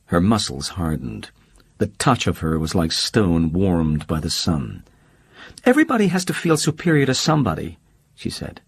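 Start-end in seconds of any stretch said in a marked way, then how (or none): 5.6-7.8 much higher, fast, sharp-tongued socialite; Holly cutting and quick. Acted. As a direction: fire it back fast as Holly.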